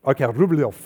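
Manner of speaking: "Rublev" in a put-on Russian accent; attempted Russian accent. Shown as a demonstration. Preacher retrying the painter's name after correction, attempted Russian accent. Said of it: "I can't pick that accent up".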